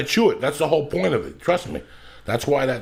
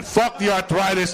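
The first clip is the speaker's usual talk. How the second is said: shouting; 6 semitones higher than usual. Retold or imitated. Shouted in quoted rant.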